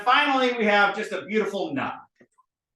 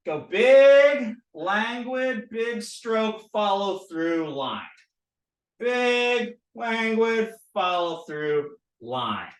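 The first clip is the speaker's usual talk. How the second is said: drawn-out words following the pen stroke; languid; 4 semitones higher, much slower than usual. Shown as a demonstration. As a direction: stretch each word like a long brushstroke. Voices the slow pen strokes.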